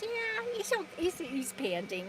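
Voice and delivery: high, soft little dog voice. Pretend. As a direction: speak for the dog, high and hushed. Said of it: "He says".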